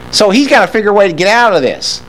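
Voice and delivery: usual speaking voice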